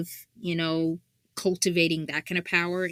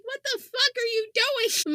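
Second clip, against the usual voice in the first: mock motherly rant, pitched up high. Mother ranting at her grown son in the afterlife, high mock scolding. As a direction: scold him like an exasperated mom. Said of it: "I'm hearing the rant now".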